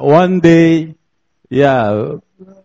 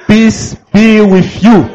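higher, louder blessing called out to the room; raised voice calling out the blessing; louder greeting; 4 semitones higher than usual. Shown as a demonstration. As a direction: lift it up so the church hears. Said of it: "I said, "Stand up".

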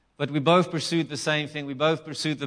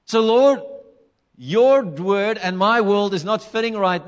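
raised, forceful preacher's declaration; higher, louder sermon delivery; emphatic preaching; 4 semitones higher, 5 dB louder than usual. Real, not acted. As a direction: preach it out with bold conviction.

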